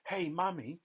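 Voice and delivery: high, demanding call to her mother; spoiled girl's sharp call; sudden shout. A narrator's reading. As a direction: yank Mummy's attention. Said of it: "shouted Veruca Salt suddenly".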